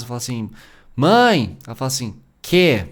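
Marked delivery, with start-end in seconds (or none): 1.0-1.6 put-on child calling mom from another room, loud; loud call to mother in the kitchen. 2.4-2.9 short, sharp and loud; irritated mother answering the kid; impatient mother. Retold or imitated. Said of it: "eu entendi que ela tava brava".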